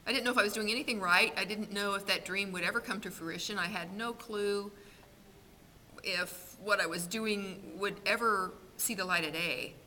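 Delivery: ordinary talk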